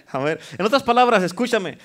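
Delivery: normal talking voice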